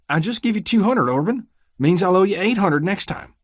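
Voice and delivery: high, anxious stammer; nervous plea. Acted. Vernon reminding the deputy he paid, nervous. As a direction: plead his case timidly.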